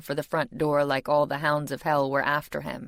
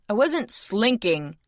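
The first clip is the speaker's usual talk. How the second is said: slow, higher, offended denial; indignant; 5 semitones higher, 4 dB louder, slower than usual. A narrator's reading. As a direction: protest the accusation. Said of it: "he told me indignantly".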